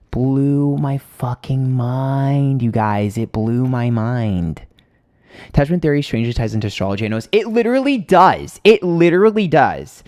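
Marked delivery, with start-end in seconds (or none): 0.1-4.6 slow, heavily drawn-out words; awestruck emphasis. Real, not acted. Telling viewers how the therapist amazed her, slow and awestruck. 7.3-10.0 high-pitched, excited insistence; emphatic insistence. Real, not acted. Insisting to viewers that attachment ties into astrology, excited and emphatic.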